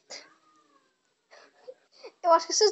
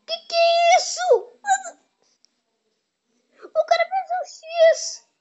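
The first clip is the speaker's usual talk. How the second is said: much higher, slow, stunned disbelief; astonished; 10 semitones higher, slower than usual. Real, not acted. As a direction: gasp it out in shock at the play. Shocked at another player's move. (espanto, voz bem aguda e lenta)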